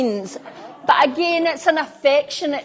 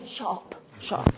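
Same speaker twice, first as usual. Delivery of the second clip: unvoiced whisper, much softer; whispered hush; 6 semitones lower, 11 dB quieter than usual. Parent telling kids to be quiet, whispered hush. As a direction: whisper it low to shush someone.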